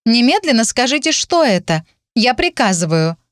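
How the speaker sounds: whiny spoiled pouty drawl. Acted. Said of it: "Закапризничала, вживаясь в роль принцессы".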